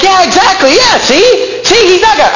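high, gleeful mock voice of the coworker; Jehovah's Witness guy. Coworker gloating to the preacher, high mock voice. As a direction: squeak it out like he just won the argument. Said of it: "And he's like".

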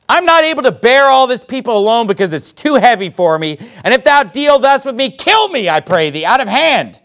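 high, exasperated plea to God; acted despair, pitch jumping on the plea; upset pleading. Moses begs the Lord to kill him, upset pleading. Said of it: "yelling at God about it".

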